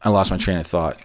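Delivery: usual speaking voice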